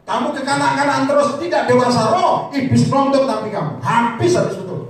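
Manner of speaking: loud, high-pitched exhortation; full-force preaching. Real, not acted. Preacher confronting those excusing themselves through ministry, full-force preaching. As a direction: drive the rebuke home at full volume.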